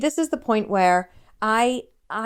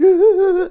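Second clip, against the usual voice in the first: teary laugh; 11 semitones higher, 9 dB louder than usual. Retold or imitated. Sobbing while retelling the call.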